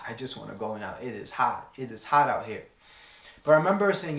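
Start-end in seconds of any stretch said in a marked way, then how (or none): 0.1-2.6 low, worn-out grumble about the heat; tired complaint. Retold or imitated. Preacher recalling canvassing in Texas heat, tired grumble. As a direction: sigh it out, drained by the heat. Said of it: "you're like".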